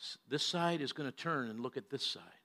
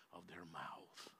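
whisper; 8 semitones lower, 22 dB quieter, much slower than usual. Real, not acted. Sermon point trailing into whisper.

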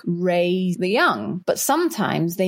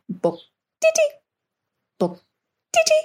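imitated bird call. Showing the pardalote's call from the garden, whistled imitation. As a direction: mimic a small bird's piping call. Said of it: "it started making its call".

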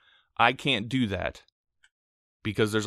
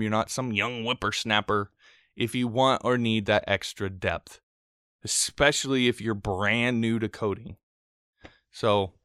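normal talking voice

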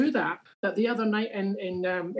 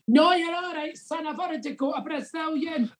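much higher mock Glasgow woman's retort; shouted reply; 9 semitones higher than usual. Woman shouting to a suitor at the back, mild mock shout. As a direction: toss it back like a cheeky Glaswegian woman. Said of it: "shouted up the back".

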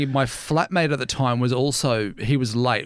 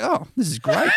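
laughing delight; 7 semitones higher than usual. Retold or imitated. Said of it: "I was like".

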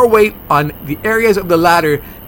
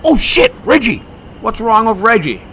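alarmed; 5 semitones higher than usual. Acted. Friend cries for help.

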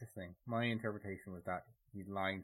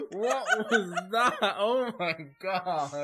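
laughing; 11 semitones higher, 16 dB louder, much slower than usual. Real, not acted. Laughing at the impression.

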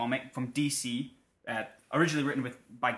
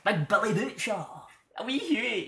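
higher, louder mock Glaswegian gangster; Glasgow accent; 7 semitones higher, 4 dB louder than usual. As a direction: ham up a wee Glasgow hard man. Imitating Wee Huey Glasgow gangster.

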